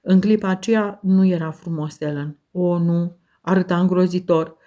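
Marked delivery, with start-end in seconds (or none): none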